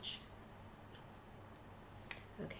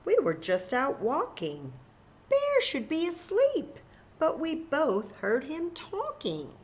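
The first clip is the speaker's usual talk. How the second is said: lightly voiced Hare; 10 semitones higher, 5 dB louder than usual. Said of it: "says Hare".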